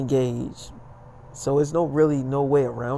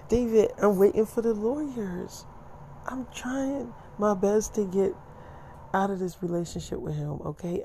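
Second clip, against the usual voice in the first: higher, slowing as it goes, imitated; lightly acted plea for patience; mock exasperated plea; 5 semitones higher than usual. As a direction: plead it higher and drag out the trying. Acting partner's exasperated plea.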